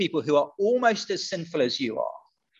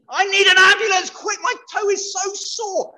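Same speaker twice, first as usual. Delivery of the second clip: mock panicked caller; 13 semitones higher, 5 dB louder than usual. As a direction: wail for help over a sore toe, hammed up. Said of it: "I've got a terribly injured foot".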